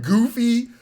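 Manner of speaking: usual speaking voice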